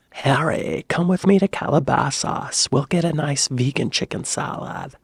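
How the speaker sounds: put-on Harry Potter as a valley girl; valley girl accent. Inviting Harry to lunch in Calabasas, valley girl accent. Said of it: "Harry Potter goes to the valley".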